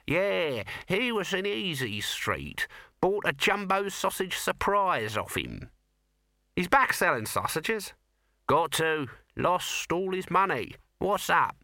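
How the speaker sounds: high nasal little corporal; squeaky weaselly watchman voice; Nobby voice. A narrator's reading. Nobby character voice.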